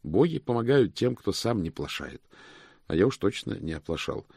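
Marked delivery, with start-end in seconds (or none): none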